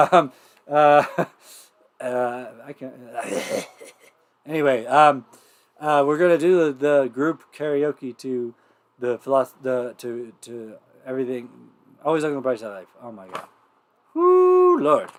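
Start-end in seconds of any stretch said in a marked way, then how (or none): 0.0-3.7 breaking up laughing between words; too tickled to get the words out; real giggles. Real, not acted. Host cracking up at own horrible accent, laughing helplessly. 14.1-14.8 long, high, loud "woo"; recovering whoop. Real, not acted. Host shaking off the giggles, high whoop.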